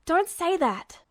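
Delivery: a harsh rebuke to his friend. A narrator's reading. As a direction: snap it out harshly. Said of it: "his voice harsh".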